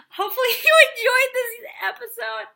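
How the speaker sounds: high, loud, giggly delivery; laughing sign-off. Real, not acted. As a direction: laugh through the words. Laughing while signing off.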